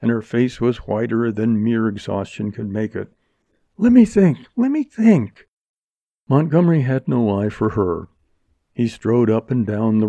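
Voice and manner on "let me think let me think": high, loud, frantic plea; desperate. Acted. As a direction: force herself to think over the panic. Desperate woman thinking aloud.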